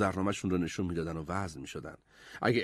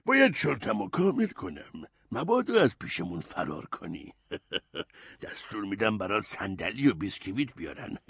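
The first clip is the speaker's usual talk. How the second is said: put-on old statesman with little chuckles; kindly grand vizier; 3 semitones lower than usual. A narrator's reading. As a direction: speak kindly, chuckling between phrases. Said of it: "صدر اعظم با مهربونی گفت".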